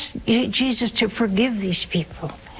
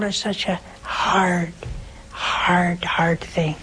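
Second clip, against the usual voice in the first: fading to a whisper; 4 semitones lower, slower than usual. Real, not acted.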